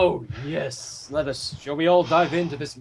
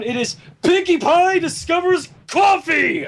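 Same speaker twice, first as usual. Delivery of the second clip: slow, higher, loud proclaiming shout; grand announcement; 11 semitones higher, 4 dB louder than usual. Acted. Host unveiling the cursed fanfic to friends, slow triumphant shout. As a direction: unveil the title like a villain's triumph.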